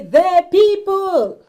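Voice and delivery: slow, loud, high rallying cry; slow, loud, high chant of The people; defiant shout. Shown as a demonstration. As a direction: fist in the air, proclaim it to the crowd. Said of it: "stamp his feet, beat his chest... raise his fist in the air and say".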